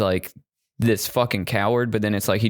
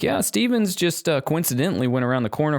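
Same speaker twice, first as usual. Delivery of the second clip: mock officer; 6 semitones higher than usual. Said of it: "he's like".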